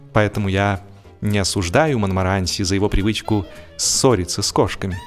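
plain reading voice

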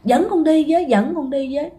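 put-on high, pleading child's cry, fading at the end; crying out in sleep. Child in a trance begging her father to take her, high crying plea. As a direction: call after him high, then let it sink. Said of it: "mà bạn kêu".

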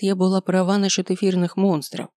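plain reading voice

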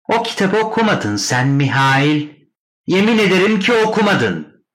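high, loud nagging voice; nagging sister. A narrator's reading. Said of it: "söylene söylene geliyordu". (tiz sesle söylenen abla)

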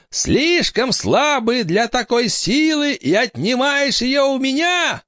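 very high, slow shrieking witch. Acted. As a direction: screech it like a furious witch. Witch rants.

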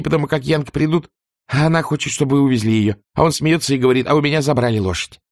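put-on high, quick breathless servant girl; chattering maid. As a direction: babble it out in a rush. Prissy retells meeting.

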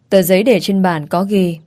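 ordinary delivery, nothing marked

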